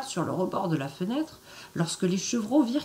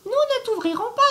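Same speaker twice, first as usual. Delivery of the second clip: put-on much higher and louder childlike voice; little goat kids crying out; 4 dB louder than usual. The little goats refuse the wolf, high and loud. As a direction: shout it up high like tiny goats. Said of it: "crièrent-ils".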